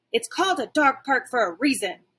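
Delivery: dog warning the squirrel, growling. Acted. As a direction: growl it through bared teeth. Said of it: "I growl".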